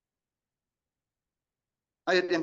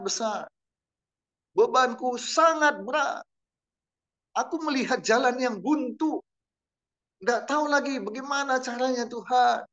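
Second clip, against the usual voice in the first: a higher, earnest cry to God; pleading prayer; 6 semitones higher than usual. Pretend. Preacher voicing a desperate believer praying, higher and pleading. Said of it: "Ketika kita betul-betul mengatakan".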